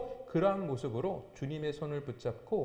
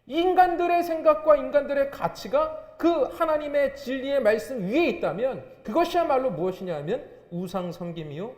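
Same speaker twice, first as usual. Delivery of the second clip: loud, high-pitched sermon delivery; full-force preaching; 9 semitones higher, 8 dB louder than usual. Real, not acted. Preacher warning the congregation about putting human values above God, full force. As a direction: drive the warning home with raised voice.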